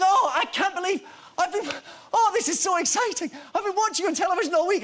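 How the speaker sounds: high-pitched, gushing fan imitation; very excited old fan. Actor imitating an old man thrilled to find filming, very excited. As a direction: gush in a high voice, overcome with excitement. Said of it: "he starts getting very excited".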